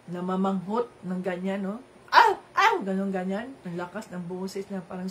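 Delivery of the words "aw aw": loud, high barking "Aw! Aw!" like a mad dog; imitated dog bark. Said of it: "parang asong ulol". (tahol na parang asong ulol, malakas at matinis)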